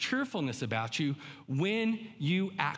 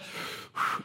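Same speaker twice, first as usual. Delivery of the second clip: sigh. Retold or imitated. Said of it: "He wants us to look to him to say".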